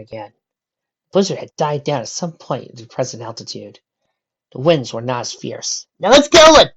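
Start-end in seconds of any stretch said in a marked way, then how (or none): 6.0-6.6 high, loud rallying cry; rally the group forward; eager call. A narrator's reading. Pony urges group on.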